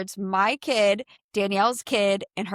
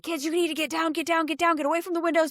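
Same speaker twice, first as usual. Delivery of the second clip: urgent warning; 8 semitones higher, faster than usual. Retold or imitated. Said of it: "I run inside and I'm like".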